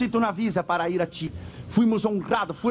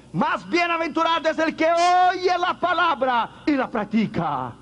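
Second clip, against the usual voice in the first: high ringing proclamation; 7 semitones higher than usual. Acted. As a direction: call it out high like a revelation. Preacher proclaims Jesus' words.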